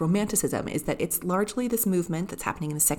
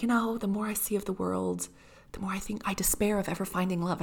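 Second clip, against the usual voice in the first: higher, soft mock despair; despairing Marianne; 4 semitones higher, 4 dB quieter than usual. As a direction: sigh it out with hammed-up hopelessness. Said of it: "great moment where she's like".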